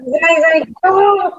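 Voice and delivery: high, slow, delighted thanks; happy yay. Real, not acted. Guest thanked by the host, slow and delighted. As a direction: beam it back.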